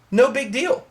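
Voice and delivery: usual speaking voice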